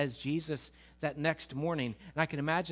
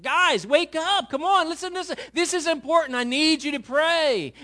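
urgent wake-up call; 12 semitones higher, 10 dB louder than usual. Acted. Jesus wakes sleeping disciples to pray, urgent shout. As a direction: rouse sleepers with an exasperated, high shout.

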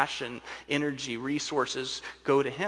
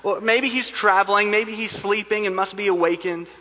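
raised, louder mock speculation; sarcasm; 7 semitones higher, 6 dB louder than usual. Elijah mocks the prophets' silent god, sarcastic. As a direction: taunt them with higher, louder mock helpfulness. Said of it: "spiritual gift of sarcasm".

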